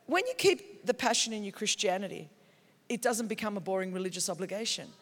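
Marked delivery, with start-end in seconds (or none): none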